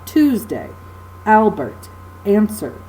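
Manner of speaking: Tuesday, Albert, answer, each accented on its first syllable; stress on first syllable. Shown as a demonstration. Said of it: "notice the first syllable is accented".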